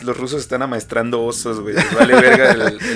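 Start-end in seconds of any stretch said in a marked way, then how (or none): 1.8-3.0 squealing high laugh. Real, not acted. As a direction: burst out laughing mid-line.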